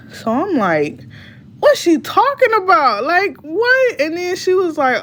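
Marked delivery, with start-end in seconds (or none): 1.6-4.0 incredulous. Retold or imitated. Daughter reacting to mom's accusing texts, incredulous. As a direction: replay a baffled outburst, pitched way up.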